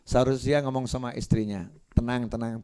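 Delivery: ordinary delivery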